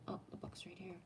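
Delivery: a quick unvoiced whisper to herself. Real, not acted. Searching for a book, she whispers to herself. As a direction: whisper it aside, off the main talk. Aside while looking for book.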